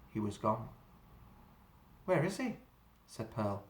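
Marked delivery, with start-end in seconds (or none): 2.1-2.6 quivering voice. A narrator's reading. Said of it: "her voice quivering".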